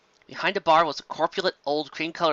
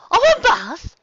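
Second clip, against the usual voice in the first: much higher, loud delighted gasp; Rarity thrilled; 9 dB louder, slower than usual. Acted. Rarity suddenly revives, thrilled by a bath. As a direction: snap awake at the word bath.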